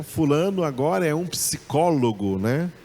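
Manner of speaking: normal talking voice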